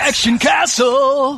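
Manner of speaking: high slow booming title shout; announcer shout. Pretend. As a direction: shout the title like a game intro.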